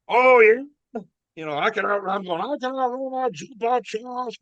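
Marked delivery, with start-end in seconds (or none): 0.1-0.6 loud, high Irish challenge. Retold or imitated. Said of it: "there's this Irish voice that says". 1.6-4.4 high-pitched imitation of drunken bragging; bellicose boasting. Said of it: "Carrying on".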